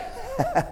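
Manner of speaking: short high, soft chuckle. Real, not acted. Preacher amused mid-illustration, soft chuckle. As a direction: let a little laugh slip out.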